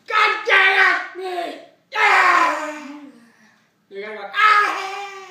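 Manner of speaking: loud, slow, drawn-out yells; excited shouting. Real, not acted. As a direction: yell it out in disgust. Reacting loudly to the taste.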